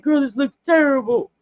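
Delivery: dismayed complaint. Retold or imitated. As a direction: complain that it looks bad. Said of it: "I'm like".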